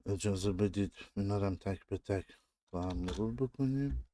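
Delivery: ordinary delivery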